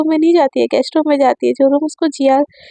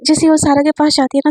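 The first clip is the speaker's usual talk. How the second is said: plain reading voice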